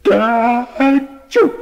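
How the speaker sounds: high loud achoo; acted sneeze. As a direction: sneeze it out big. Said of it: "as he sneezed".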